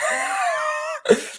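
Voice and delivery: high burst of disbelieving laughter; squeaky astonished laugh; incredulous laugh. Real, not acted.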